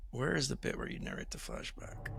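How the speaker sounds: very soft, under the breath; quiet aside to yourself; talking to oneself. Real, not acted. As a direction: mumble it while searching through the rules. Searching rules, talking to self.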